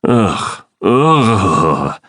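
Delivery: disgusted shuddering groan; a shiver of disgust; shuddering ugh. A narrator's reading. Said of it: "said with a sort of shudder".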